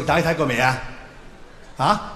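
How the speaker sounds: normal talking voice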